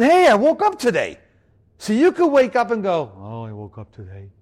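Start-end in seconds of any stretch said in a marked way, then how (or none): 0.0-1.2 bright cheerful wake-up greeting, higher; upbeat morning cheer. Shown as a demonstration. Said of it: "Same words, different attitude". 3.1-4.3 much lower, very soft and glum; deflated, mopey mumble; gloomy. Shown as a demonstration. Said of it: "Same words, different attitude".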